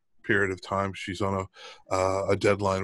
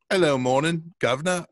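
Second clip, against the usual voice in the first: mock English accent; 7 semitones higher, 4 dB louder than usual. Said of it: "an English greeting".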